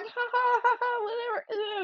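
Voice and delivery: put-on high, taunting kids' laugh; mocking laugh. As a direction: brush it off with a high jeering laugh. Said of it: "And they're like".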